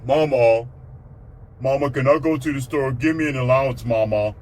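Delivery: pestering son calling his mama over and over; mock a grown boy badgering mother for money; imitated big son. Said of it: "looking down there like".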